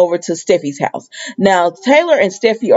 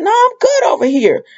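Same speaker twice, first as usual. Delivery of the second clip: sassy brush-off; 14 semitones higher than usual. Retold or imitated. Sheila brushing Deacon off.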